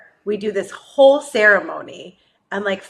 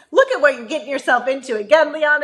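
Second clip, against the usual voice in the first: mock self-scolding; 5 semitones higher than usual. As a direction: lightly scold yourself as your own brain. Her brain scolds her, retold.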